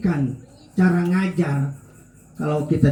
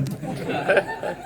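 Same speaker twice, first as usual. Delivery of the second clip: laugh; 8 dB quieter than usual. Real, not acted. Speaker laughs at punchline.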